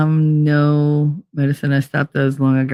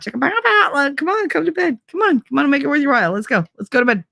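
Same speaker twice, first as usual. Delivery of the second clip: high teasing come-to-bed call; playful coaxing; 9 semitones higher, faster than usual. Retold or imitated. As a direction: lure him to bed playfully. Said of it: "I literally am like".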